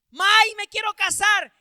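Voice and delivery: high, loud mock plea to marry; pleading sister. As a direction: cry out like an eager young sister.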